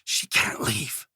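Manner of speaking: slow, voiceless hiss; a slow whispered hiss. A narrator's reading. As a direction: hiss it low and urgent. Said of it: "she hissed".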